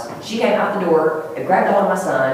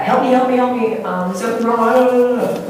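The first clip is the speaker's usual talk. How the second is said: high, louder cry for help, retold; mimicked distressed plea; 6 semitones higher, 3 dB louder than usual. Woman bursting in the back door, mimicked distressed plea. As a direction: imitate a woman calling out for help, drawn out at the end.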